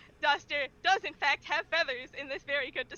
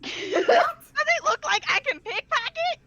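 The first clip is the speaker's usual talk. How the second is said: higher, louder, laughing disbelief; incredulous squeaky bird; 11 semitones higher, 6 dB louder than usual. Acted. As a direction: scoff at the accusation. Tiny bird protests innocence.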